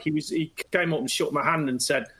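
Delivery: ordinary talk, nothing marked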